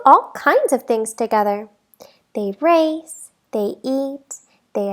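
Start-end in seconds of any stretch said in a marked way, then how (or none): none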